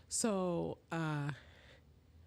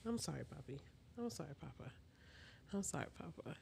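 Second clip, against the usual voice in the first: gently hushed, consoling; tender apology; 3 semitones lower, 15 dB quieter, faster than usual. Real, not acted. Mother softly apologizing to her sleepy son.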